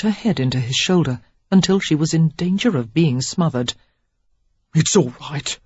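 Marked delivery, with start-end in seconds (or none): 4.7-5.6 gruff comfort. A narrator's reading. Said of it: "he said gruffly".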